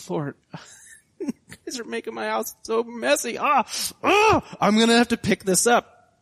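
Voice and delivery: high-pitched, hammed-up despair; exasperated wail. Dad crying to the Lord over messy house, exasperated wail. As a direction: wail it like an overwhelmed parent.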